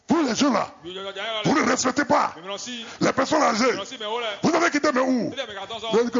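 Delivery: lightly voiced scolding elder; scolding old woman. Preacher voicing the old woman scolding young people, high and indignant. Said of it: "La maman dit".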